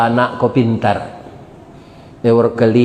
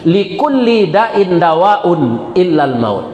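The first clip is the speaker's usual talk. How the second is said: slow chanted Arabic recitation; recited hadith; 4 semitones higher, slower than usual. Acted. Preacher quoting the Prophet in slow reverent recitation.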